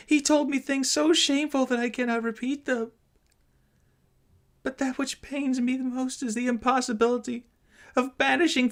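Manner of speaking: put-on a frightened child fighting back sobs; trembling, sob-choked. A narrator's reading. Daughter pleading with her mother, high tearful girl's voice. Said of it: "began to sob again".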